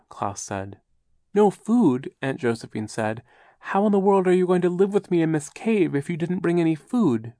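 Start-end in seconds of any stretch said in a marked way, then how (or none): 1.3-7.3 high, shocked fussy aunt; alarmed fussy aunt. A narrator's reading. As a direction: cry out like a flustered old aunt.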